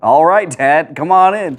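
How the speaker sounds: put-on loud, high teenage holler to Dad; calling back. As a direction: call out to let him in. Son calls back through door.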